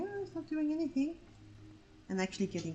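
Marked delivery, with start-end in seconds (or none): 0.0-1.2 higher, dismissive whine; mock dog owner. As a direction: mimic a careless dog owner. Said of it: "reasons, like".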